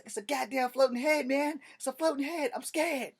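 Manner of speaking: high, loud panicked cry; panicked kid. Retold or imitated. Boy telling his dad about the floating head, panicked. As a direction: blurt it out in terror.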